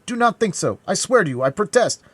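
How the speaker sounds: high, loud insistence; urgent protest. A narrator's reading. D'Artagnan swearing to dying Brisemont, high urgent protest.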